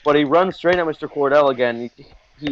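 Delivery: ordinary talk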